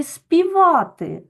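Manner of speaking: «співати» said high, loud and slow; teacher highlights «співати»; emphasized example word. Shown as a demonstration. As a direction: spotlight «співати» for the class. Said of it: "teacher stresses example word".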